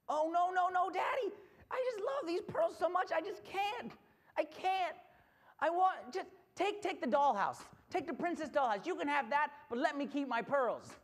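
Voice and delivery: high-pitched acted begging; pleading little girl. Girl offering dollhouse to keep pearls, high desperate pleading. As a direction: protest in a rush of childish pleading. Child begs dad, bargaining.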